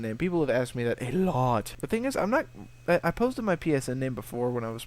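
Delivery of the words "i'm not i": usual speaking voice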